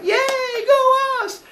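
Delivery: high, squeaky self-cheer; the women cheer for themselves in a high squeal. Retold or imitated. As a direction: squeal it like a delighted crowd.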